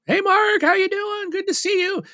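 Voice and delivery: very high, bright imitation of the store lady; cheery greeting. Store lady welcoming Mark back, very high cheery voice. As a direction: go way up, delighted to see him.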